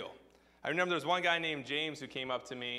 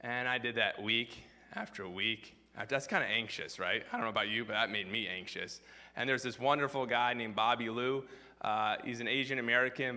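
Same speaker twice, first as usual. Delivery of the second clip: usual speaking voice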